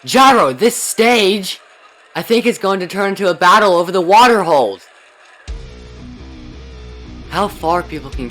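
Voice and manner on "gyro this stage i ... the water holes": overacted anime dub. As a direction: announce it like a big reveal. Dramatic announcement of the stage.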